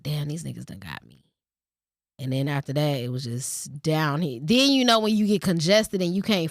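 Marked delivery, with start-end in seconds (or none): 0.0-1.2 resigned mutter. Retold or imitated. Resigned at getting sick.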